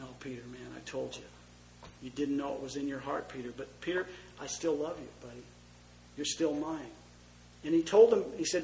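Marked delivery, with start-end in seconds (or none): 0.0-6.9 tearful tenderness. Acted. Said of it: "with tears coming down his eyes".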